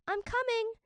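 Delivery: high, loud call across water. Acted. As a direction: shout to reassure the stranger. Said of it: "Minli called again".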